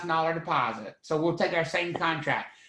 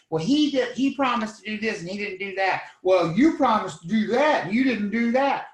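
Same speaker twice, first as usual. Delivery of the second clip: higher-pitched, insistent tit-for-tat; mock bickering; 4 semitones higher, 5 dB louder than usual. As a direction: act out two parties squabbling, getting louder on the comeback. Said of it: "If they started bickering back and forth".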